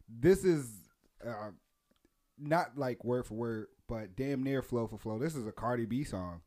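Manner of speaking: ordinary talk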